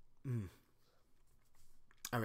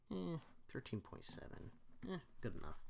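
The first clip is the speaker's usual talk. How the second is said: plain reading voice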